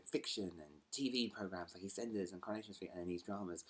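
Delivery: ordinary talk, nothing marked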